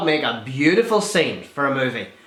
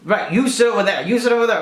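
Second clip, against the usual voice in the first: stern teacher; 5 semitones higher, faster than usual. Retold or imitated. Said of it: "the teacher".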